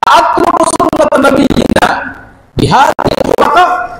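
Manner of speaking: high, drawn-out Arabic declamation; imitating a defiant shout; retold indignant cry. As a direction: cry it out slowly, pitched high. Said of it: "দাঁড়িয়ে চিৎকার দিয়ে বলেছেন".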